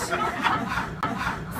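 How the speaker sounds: breathless giggle; stifled laughter; Vic cracking up. A narrator's reading. Vic laughs at the narrator's plea, breathy giggle.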